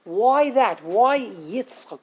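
ordinary talk, nothing marked

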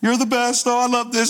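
dog's pretend voice. Pastor voicing his German Shepherd Zion, goofy sing-song voice. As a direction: play the happy, clueless dog. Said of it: "we actually have a pretend voice for him".

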